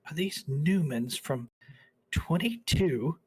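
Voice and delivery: put-on an old vintner's thrilled recognition; delighted connoisseur. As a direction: savour each word like a wine lover. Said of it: "she's like".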